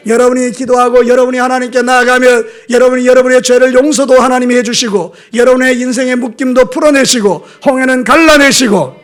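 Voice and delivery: loud, high-pitched pulpit proclamation; full-force preaching. Real, not acted. Pastor proclaims heaven's open door to congregation, full-force preaching. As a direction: declare it ringing out over the congregation.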